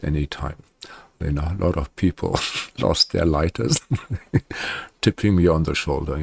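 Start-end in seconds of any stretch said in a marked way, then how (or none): 3.8-4.9 voiceless, amused chuckle; short run of breathy chuckles. Real, not acted. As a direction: laugh lightly at your own story. Amused telling lighter story.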